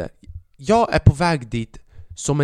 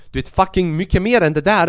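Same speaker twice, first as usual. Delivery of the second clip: ordinary talk, nothing marked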